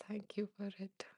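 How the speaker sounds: moved whisper of thanks to a viewer. Real, not acted. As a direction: breathe the thanks out, too moved to voice it.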